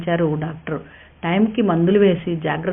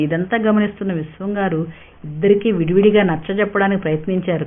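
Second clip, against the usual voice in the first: plain reading voice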